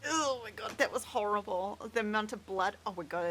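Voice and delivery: ordinary talk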